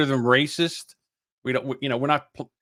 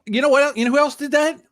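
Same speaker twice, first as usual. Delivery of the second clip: ordinary talk, nothing marked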